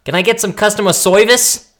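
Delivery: put-on loud, high-pitched New York accent; Brooklyn-Boston accent. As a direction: call out in a brash Brooklyn accent. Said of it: "with the accent".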